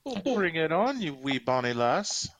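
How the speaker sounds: cocky, lilting Scottish burr; horrible Scottish accent. Acted. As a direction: accept the challenge in mock Scottish. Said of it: "Competing with my horrible Scottish accent".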